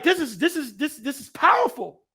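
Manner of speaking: high, loud stammered amazement; awestruck excitement. Retold or imitated. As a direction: stumble over the words, overwhelmed. Own amazed reaction retold.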